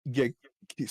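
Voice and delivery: speaking through hiccups; hiccuping. Real, not acted. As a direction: cut off by a hiccup. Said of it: "sorry, I'm, I got hiccups".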